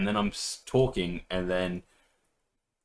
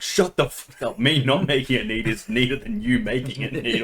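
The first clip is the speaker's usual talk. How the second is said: higher, mildly heated comeback; mock angry retort; 3 semitones higher, 5 dB louder than usual. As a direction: act out snapping back at a nitpicking friend. Acted quarrel with co-player.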